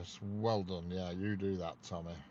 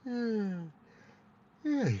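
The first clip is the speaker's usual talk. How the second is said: talking through a big yawn; words stretched by a yawn; 14 semitones higher, 6 dB louder than usual. Real, not acted.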